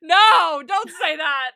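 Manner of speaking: loud, scandalized shout through laughter; laughing protest. Real, not acted. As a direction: cry out in mock horror. Laughing protest at co-host's crude joke.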